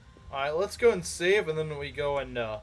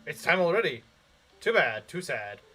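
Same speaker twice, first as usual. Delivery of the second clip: announcer voice. Shown as a demonstration. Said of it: "announcer voice".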